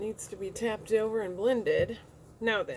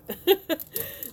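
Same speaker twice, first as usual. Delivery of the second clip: real laugh, high and loud; high bright laugh; 10 semitones higher, 7 dB louder than usual. Real, not acted. Amused at how the eye looks, a loud high laugh. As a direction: burst out laughing, rising.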